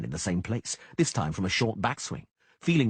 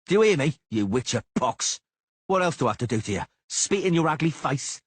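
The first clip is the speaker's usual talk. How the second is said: higher, louder spitting insult; furious insult; 7 semitones higher, 5 dB louder than usual. A narrator's reading. Cicada insulting the Witcher, higher and louder. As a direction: jeer in fury.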